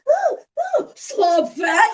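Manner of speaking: put-on high, slow childish taunt; a light mocking kid voice; mocking little kid. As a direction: mimic a bratty kid teasing. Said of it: "acts like a little fucking kid making all these".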